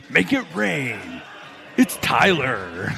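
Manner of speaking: stretched, hammed-up announcer impression; stripper DJ voice. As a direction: introduce him like a strip club DJ. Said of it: "in his stripper voice".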